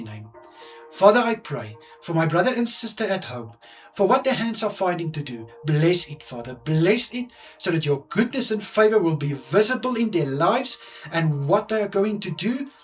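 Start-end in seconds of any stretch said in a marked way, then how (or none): none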